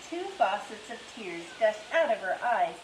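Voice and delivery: usual speaking voice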